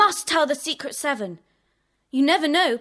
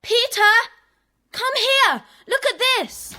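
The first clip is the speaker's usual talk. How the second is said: excited calling; 5 semitones higher than usual. Acted. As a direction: call him over, thrilled by what you've seen. Janet calls Peter to the telescope.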